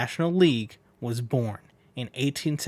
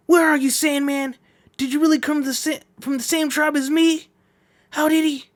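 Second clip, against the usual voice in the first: high, loud shocked shouts; a rider yelling in disbelief; astonished pursuer; 12 semitones higher, 4 dB louder than usual. Acted. The rider shouts in shock as Sandman escapes, high and loud.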